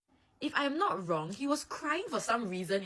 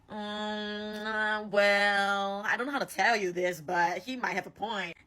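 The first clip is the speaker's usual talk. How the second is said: slow, stretched-out reluctant hedging; awkward hedging; slower than usual. Acted. Osamu breaking it to crying Atsumu, awkward hedging. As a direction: draw out the hesitation before breaking bad news. Brother reluctantly agrees with the insult.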